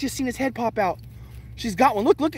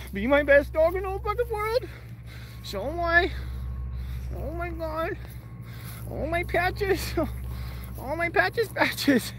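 doting dog talk; 5 semitones higher, slower than usual. Real, not acted. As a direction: owner cooing high at his dog Patches. Baby-talking his dog.

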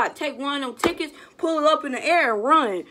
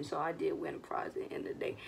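whispered aside; 7 semitones lower, 14 dB quieter, faster than usual. Real, not acted. Soft aside at the end.

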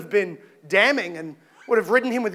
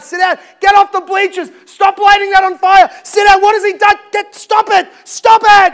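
high, loud shouting over a crowd; imploring shout; 9 semitones higher, 11 dB louder than usual. Acted. Pilate imploring a rioting crowd, high frantic shouting. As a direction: shout them down, desperately imploring. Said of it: "as he's imploring with this crowd".

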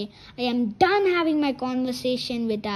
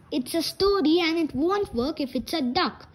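usual speaking voice

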